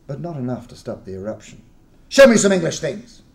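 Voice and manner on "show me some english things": loud, high insistent demand. Acted. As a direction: order it, fed up. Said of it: "he demanded".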